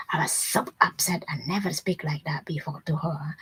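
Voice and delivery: hushed, secretive retelling; whispered confession. Retold or imitated. Neighbor confiding to her friend, a hushed whisper. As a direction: whisper it like a guilty admission.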